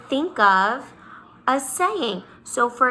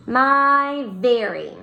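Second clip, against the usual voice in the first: slow spelled-out phrase; much slower than usual. Shown as a demonstration. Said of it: "Miss Bowden said was very".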